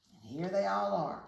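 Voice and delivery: ordinary delivery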